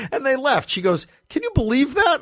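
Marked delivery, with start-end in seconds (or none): 1.3-2.2 quoting her in a much higher voice; high-pitched disbelief. Retold or imitated. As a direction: pitch it way up, incredulous. Ticket girl quoted, high.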